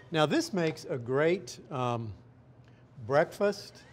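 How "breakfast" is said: ordinary talk, nothing marked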